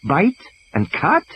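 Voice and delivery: slow, high questioning echo; puzzled. A narrator's reading. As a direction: turn the words over, baffled.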